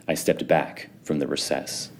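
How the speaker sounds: plain reading voice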